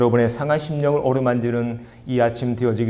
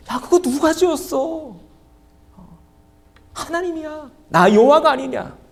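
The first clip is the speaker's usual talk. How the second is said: much higher, commanding voice of God; God speaking in a raised tone; 12 semitones higher than usual. Acted. Pastor voicing God rebuking Moses in a high tone. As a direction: raise the pitch and rebuke like a sovereign. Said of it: "높은 억양으로 이렇게 말씀하십니다".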